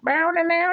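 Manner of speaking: high wailing vocal guitar riff; mimicked guitar riff. Showing how music needs no translation, mimicked guitar riff. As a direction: imitate an electric guitar with your voice. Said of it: "a really cool guitar riff".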